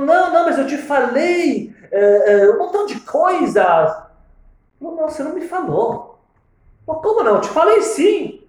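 loud high urgent insistence; heated insistence. Retold or imitated. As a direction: insist it happened. Phone back-and-forth, insisting.

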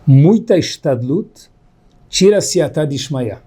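each word laid down slowly and weightily; slow solemn emphasis. Shown as a demonstration. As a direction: dictate it slowly so it gets written down. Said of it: "uma frase que tem que ser anotada". (devagar e solene, palavra por palavra)

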